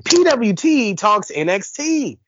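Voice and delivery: slow, high, drawn-out announcer call; cartoony announcer voice. Shown as a demonstration. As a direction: boom out the show name like a ring announcer. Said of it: "whenever you say P, you gotta say".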